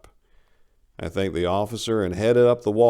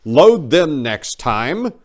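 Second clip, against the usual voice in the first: slow, louder note to self; slow mental note; 8 semitones higher, 10 dB louder, much slower than usual. Acted. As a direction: spell it out to yourself.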